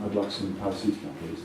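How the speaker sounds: soft, low request; discreet customer. Acted. Customer asking at the local chemist, soft and low. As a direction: ask softly and low at the counter. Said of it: "go to the local chemist and say".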